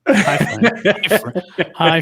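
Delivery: greeting through a laugh. Acted. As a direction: copy the hello, giggling. Mimicking greeting while laughing.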